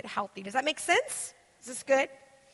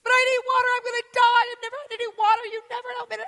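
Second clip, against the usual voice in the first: put-on whiny child; 10 semitones higher, 6 dB louder, faster than usual. Mother imitating her whining kid begging for water. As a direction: wail like a thirsty toddler. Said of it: "in a nice way".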